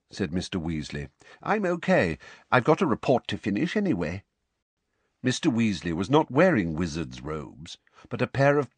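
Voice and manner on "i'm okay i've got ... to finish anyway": put-on high young woman's voice, brisk; Tonks voice. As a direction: brush off concern quickly. Tonks insists she's fine.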